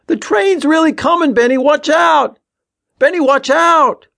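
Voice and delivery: very high, loud screamed warning; frantic yelling. A narrator's reading. Tommy to Ben as the train comes, frantic yelling. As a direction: scream at your brother to look out. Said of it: "cut off by Tommy's yelling".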